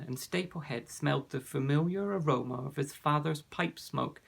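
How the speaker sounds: usual speaking voice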